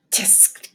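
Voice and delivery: unvoiced tsk of disapproval; a soft tisk, clicked not voiced; disapproving tongue click. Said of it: "clicked Janie".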